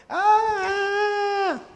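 long, high, sustained "aah"; childish wail to block out a speaker; mock drowning-out cry. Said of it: "that's what they was doing".

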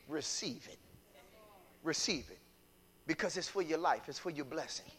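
2 drop to a soft, pleading hush; 3 gently, almost under breath; 1 soft appeal. Real, not acted. 4 preacher softly urging listeners to receive correction.

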